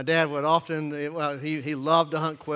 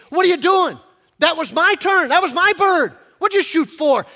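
loud, high, furious ranting; ranting and raving; 11 semitones higher, 11 dB louder than usual. Retold or imitated. Hunter yelling at his friend over a bird. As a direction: rant at him over the stolen bird. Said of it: "responded with quite a bit of ranting and raving".

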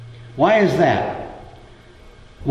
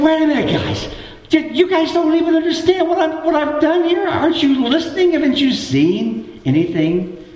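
acted exasperation; 13 semitones higher than usual. Jesus to disciples who don't understand, exasperated. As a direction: act out a teacher whose pupils just don't get it. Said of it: "because it says he was exasperated".

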